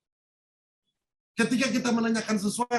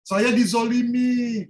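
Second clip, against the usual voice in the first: mock victim whine; 5 semitones higher, 7 dB louder, slower than usual. Acting out victim line.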